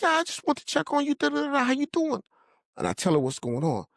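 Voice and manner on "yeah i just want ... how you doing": very high, skimming phone chat; imitated female caller. Woman calling to check on him, high-pitched imitation. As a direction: voice her way up high.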